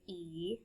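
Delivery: E as a long "ee"; long vowel sound. Shown as a demonstration. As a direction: say E as a drawn-out "ee". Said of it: "E makes a long sound".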